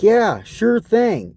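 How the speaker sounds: high, slow, sarcastic yes; fake agreement. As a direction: drag it out insincerely.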